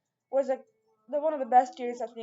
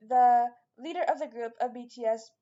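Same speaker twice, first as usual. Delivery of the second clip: usual speaking voice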